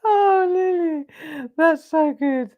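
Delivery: high laughing praise. Real, not acted. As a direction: laugh through the words. Laughing at the story.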